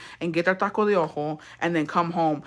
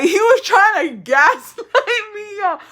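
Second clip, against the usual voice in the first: high, loud words through real laughter; helpless incredulous laugh; laughing in disbelief; 13 semitones higher, 6 dB louder, much slower than usual. Real, not acted. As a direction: stretch it out while cracking up. Cracks up retelling his gaslighting.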